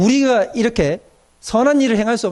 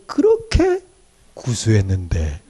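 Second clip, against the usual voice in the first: savoring; 9 semitones lower, much slower than usual. Retold or imitated. Savoring the old smoke smell.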